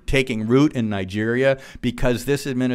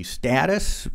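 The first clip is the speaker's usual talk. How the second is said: "status" drawn out slowly and leaned on; slow down and weigh the word "status"; stress on status; 4 semitones higher than usual. Shown as a demonstration. Lecturer to audience, pointing at one word: slow, stressed "status". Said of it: "emphasis on the, uh, word status".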